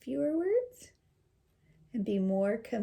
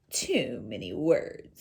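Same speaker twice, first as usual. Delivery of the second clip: low stern father; 9 semitones lower, slower than usual. Acted.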